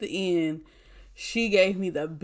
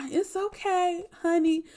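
much higher, sugary it's okay honey; sweet comforting nurse; 11 semitones higher than usual. Retold or imitated. Nurse mocking her own awkward comforting, in a much higher sweet voice. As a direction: coo it like a soothing auntie. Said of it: "I am always gonna be this little".

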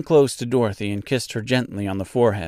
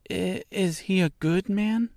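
put-on higher, hesitant child's question; a nervous little girl asking; anxious; 9 semitones higher, 4 dB quieter than usual. A narrator's reading. Dorothy asks witch about Oz, anxiously. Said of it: "inquired the girl anxiously".

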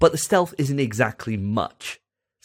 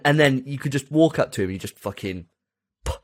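ordinary talk

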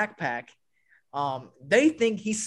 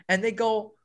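ordinary delivery

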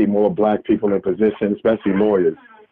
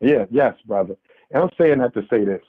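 ordinary delivery

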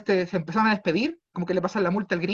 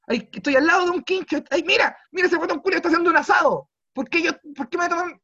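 indignant protest; 11 semitones higher, 5 dB louder than usual. Retold or imitated. Reenacting protest in park.

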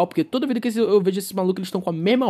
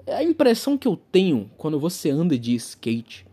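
normal talking voice

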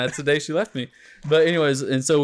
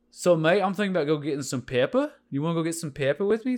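higher Aussie lilt, every sentence a question; Australian accent; 5 semitones higher, 3 dB louder than usual. Shown as a demonstration. Showing friends the Australian accent as constant questioning. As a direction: make each phrase ask something, Australian style. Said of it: "You just gotta ask questions".

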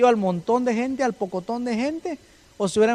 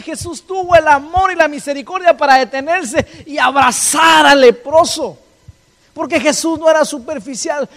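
high, loud, building sermon cry; full-force preaching; 8 semitones higher, 9 dB louder than usual. Acted. Pastor to congregation, loud fervent preaching. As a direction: preach it out to the church.

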